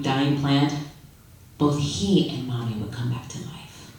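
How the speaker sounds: usual speaking voice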